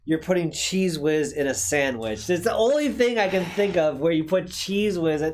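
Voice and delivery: usual speaking voice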